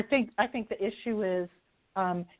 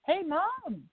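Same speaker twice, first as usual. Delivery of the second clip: put-on kids calling; 10 semitones higher than usual. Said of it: "the children say".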